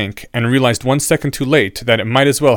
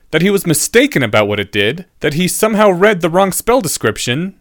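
higher-pitched questioning pupil; incredulous student; 7 semitones higher, 4 dB louder than usual. A narrator's reading. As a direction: question it in a raised, disbelieving student voice.

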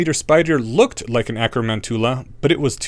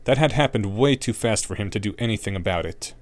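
ordinary delivery

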